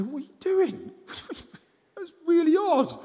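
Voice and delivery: high, laughing disbelief; half laughing at the oddness; a retold baffled question, voice cracking high with laughter; incredulous laughing. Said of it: "And I say".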